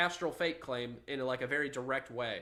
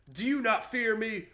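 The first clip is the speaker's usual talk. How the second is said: high, loud challenge as an offended God; 8 semitones higher, 5 dB louder than usual. Acted. God confronting the man who rejects him, theatrical indignant shout. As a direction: demand it in a raised, theatrical God voice. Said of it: "and then God says".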